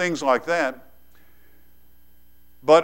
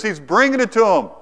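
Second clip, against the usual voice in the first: ordinary talk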